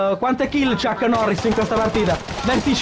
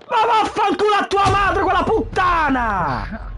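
high-pitched raging insult, slowing at the end; furious cursing; 9 semitones higher than usual. Real, not acted. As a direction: spit out the curse in fury. Raging at enemy player.

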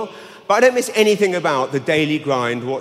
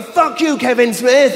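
higher, louder mock yell of offended locals; angry crowd shout; 7 semitones higher, 4 dB louder, slower than usual. Offended locals yelling at Kevin Smith, loud mock shout. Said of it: "Hundreds of people going".

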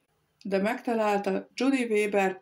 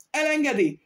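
high shrill mock shriek, louder; 7 semitones higher, 3 dB louder than usual. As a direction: shriek it like an outraged diva. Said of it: "Visította a művésznő". (visító felkiáltás)

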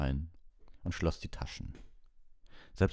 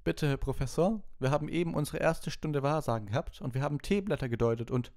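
much higher, quick pupil voice; a higher-pitched eager schoolgirl; 11 semitones higher, 3 dB louder than usual. A narrator's reading. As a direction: hurry to explain like a keen student. Hermine explains to the professor.

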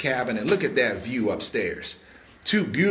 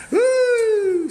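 high loud woo; whoop; 6 dB louder than usual. Real, not acted. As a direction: cheer it out.

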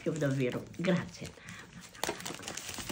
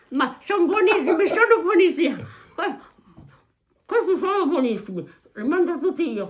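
laughing with delight; 10 semitones higher, 5 dB louder than usual. Real, not acted. Grandma eating sweets, laughing.

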